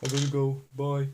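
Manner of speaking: high put-on British accent, rushed goodbye; mock British accent. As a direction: play a flustered Brit leaving the stream. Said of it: "I have an accent now".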